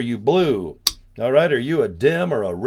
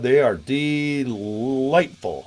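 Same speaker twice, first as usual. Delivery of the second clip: savoring; much slower than usual. Acted. Savoring baby wipes.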